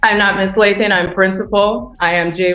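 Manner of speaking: ordinary talk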